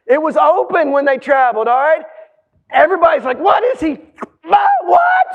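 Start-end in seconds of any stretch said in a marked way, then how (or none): 3.4-5.3 loud, high-pitched shock; comic crowd astonishment, much higher; hammed-up disbelief. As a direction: jump up into a squawk of amazement. Acts crowd seeing dead man rise.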